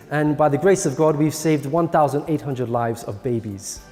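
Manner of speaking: ordinary talk, nothing marked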